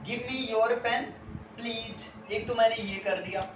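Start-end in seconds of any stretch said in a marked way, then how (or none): 0.0-2.3 "Give me your pen please" drawn out slowly, each word clear; slow teaching read. Shown as a demonstration. Teacher modelling a polite request slowly. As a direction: dictate it slowly. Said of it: "अब ध्यान से समझना".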